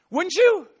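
normal talking voice

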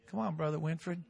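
normal talking voice

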